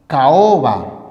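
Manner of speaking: caoba said as ca-o-ba, each vowel apart; example word. Shown as a demonstration. Teacher sounds out the example word, separating vowels. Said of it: "Por ejemplo".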